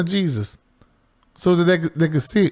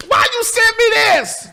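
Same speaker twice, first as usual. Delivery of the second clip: retold yell; 13 semitones higher than usual. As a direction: yell it back at her, outraged. Yelling at sister over photo.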